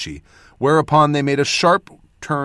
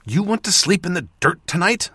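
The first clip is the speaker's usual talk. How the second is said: a mild hissed retort; 5 semitones higher than usual. A narrator's reading. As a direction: hiss it back at a companion. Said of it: "I hissed".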